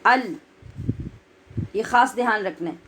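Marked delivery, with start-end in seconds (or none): 0.0-0.3 ال with a light, thin hamza. Shown as a demonstration. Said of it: "بولیے".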